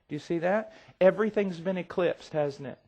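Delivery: ordinary talk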